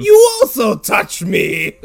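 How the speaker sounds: high, very loud, drawn-out shout; hammed-up indignant roar, word by word; mock outrage. Acted outrage, callback to earlier line.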